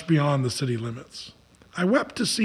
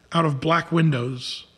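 ordinary delivery, nothing marked

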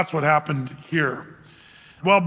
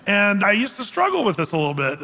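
ordinary talk

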